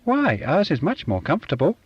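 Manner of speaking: put-on a child whining at her dad, pitched high; small girl voice. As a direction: voice a fussy little girl. Child Sonia argues.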